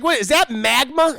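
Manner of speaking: ordinary delivery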